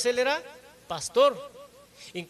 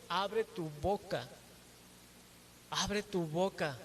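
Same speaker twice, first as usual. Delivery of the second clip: slow repeated plea; 3 semitones lower, 8 dB quieter, slower than usual. Acted. Preacher repeats the verse slowly.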